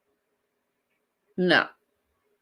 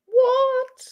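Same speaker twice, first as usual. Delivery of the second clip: a squeaky, much higher "what" in an odd voice; surprised. Real, not acted. Host hearing that Americans get biscuits with KFC, surprised. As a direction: jump up in pitch, caught off guard.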